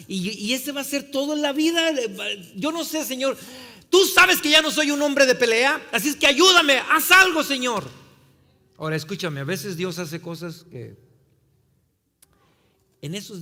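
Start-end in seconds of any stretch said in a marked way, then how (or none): 0.1-7.9 high, loud plea to God; re-enacted desperate appeal, swelling in volume; desperate crying out. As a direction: build to a shouted prayer for help. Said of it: "yo le clamé a Dios".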